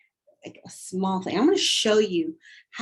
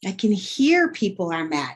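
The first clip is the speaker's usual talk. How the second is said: normal talking voice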